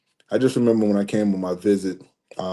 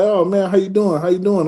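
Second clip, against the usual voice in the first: excited coach; 9 semitones higher than usual. Retold or imitated. Coach greets old player warmly.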